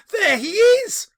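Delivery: high, loud delighted exclamation; excited cry. Acted. Young Scrooge crying out at his storybook hero. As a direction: point him out with childish glee.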